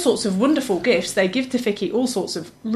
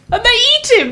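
very high, loud exclamation; gleeful shock reveal; 5 dB louder than usual. Real, not acted. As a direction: blurt out the twist at the top of the voice. Delivers the surprise spoiler ending.

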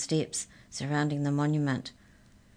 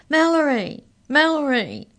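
high, loud repeated cry; 11 semitones higher, 11 dB louder than usual. A narrator's reading. Arthur at the cage crying out to Mallory, high and loud. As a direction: call out desperately through the bars. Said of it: "he cried".

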